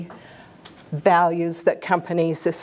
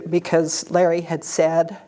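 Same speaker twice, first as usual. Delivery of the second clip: ordinary talk, nothing marked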